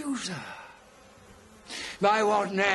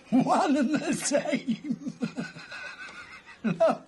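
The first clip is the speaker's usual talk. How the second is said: laughing; 7 semitones higher, slower than usual. Acted. Laughs at Darwin's claim.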